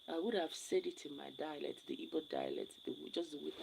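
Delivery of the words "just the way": normal talking voice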